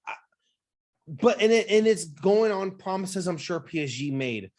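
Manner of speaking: ordinary talk, nothing marked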